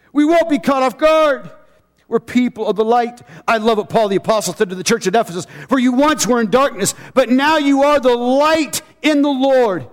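high, rallying preacher's proclamation; full-force preaching. Real, not acted. Preacher calling people of the light, preaching high.

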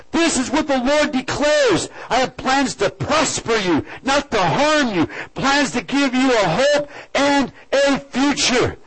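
full-force preaching. Acted. Pastor preaching the promise to his church, fervent. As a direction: proclaim the promise with fervor.

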